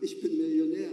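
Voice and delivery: smug grand declaration; like a millionaire. Acted. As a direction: announce your fortune to the room. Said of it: "wie ein Millionär".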